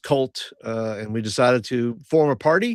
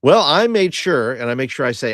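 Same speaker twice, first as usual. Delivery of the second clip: proud Hobbs; 2 semitones higher, 6 dB louder than usual. Acted. Hobbs answers Lord grandly.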